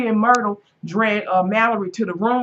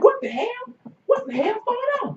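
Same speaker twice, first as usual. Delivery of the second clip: startled; 14 semitones higher, faster than usual. Retold or imitated. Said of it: "Michael looking like".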